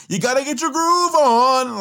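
loud, high mock Martin voice; imitating Martin. Fan mimicking Martin's line while reacting, loud and high. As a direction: belt it like Martin hyping the crowd.

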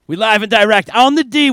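loud, high hype-man call; hyped shout. Shown as a demonstration. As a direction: blast it like a DJ intro. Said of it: "Much better".

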